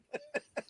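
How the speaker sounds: squealing high laugh. Real, not acted. Laughing at own story.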